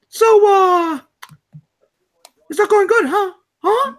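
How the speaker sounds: high squeaky cartoon mouse; taunting Mickey Mouse voice. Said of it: "Mickey Mouse was sitting on his desk and going".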